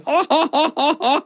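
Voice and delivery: high mock laugh, ha ha ha, in the background; imitated tourist laugh. Tourist still laughing behind the interviewer. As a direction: keep laughing blankly. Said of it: "still going".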